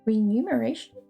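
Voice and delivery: plain reading voice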